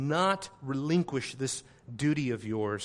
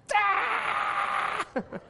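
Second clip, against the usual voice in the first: mock child wail. Mocking child screaming.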